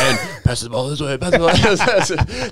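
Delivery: high, laughing, slowing mock commentary; mock play-by-play. Swim coach commentating kids' water polo, laughing. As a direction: call the water polo game through laughter. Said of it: "commentating to myself".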